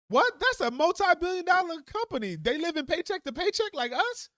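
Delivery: incredulous. Real, not acted. Own outraged reaction.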